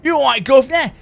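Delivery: hammed-up Cockney bloke calling out; Cockney accent. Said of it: "it sounds like you're a Cockney".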